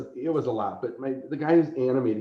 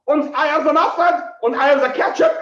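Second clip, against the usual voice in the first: loud, high-pitched caricature German; shouting mock Germans; 9 dB louder than usual. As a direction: yell in hammy stage German. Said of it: "two Germans go".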